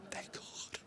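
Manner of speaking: breathy whisper of relief. Real, not acted. Storyteller relieved he got into Big Brothers, whispered thanks. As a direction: let it out under your breath. Relief after acceptance call.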